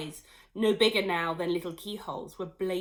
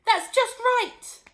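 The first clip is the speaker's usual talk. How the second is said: high, loud cry; 10 dB louder, slower than usual. Acted. Missus Cranky crying out to her shrinking mother. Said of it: "cried Missus Cranky".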